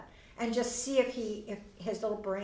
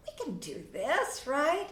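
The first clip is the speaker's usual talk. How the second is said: slow then bright, higher rising "right?"; encouraging parent; 6 semitones higher, slower than usual. Shown as a demonstration. Parent priming child before role play, upbeat encouragement. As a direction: cheer the child on. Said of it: "and you go".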